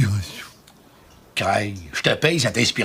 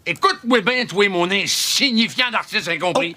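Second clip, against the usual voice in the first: furious; 11 semitones higher than usual. Real, not acted. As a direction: blow up at the slacker writer. Producer explodes at lazy writer. (producteur furieux contre l'auteur)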